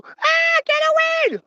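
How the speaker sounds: piercing high, loud scream; screaming little girl. Acted. Farmer's daughter fighting off the simpleton, screaming. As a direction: scream it as a terrified child.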